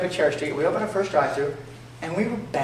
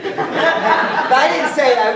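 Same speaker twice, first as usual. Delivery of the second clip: slowly, laughing through it, higher; 9 semitones higher, 6 dB louder, much slower than usual. Real, not acted. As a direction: squeeze it out between laughs. Laughing aside to audience.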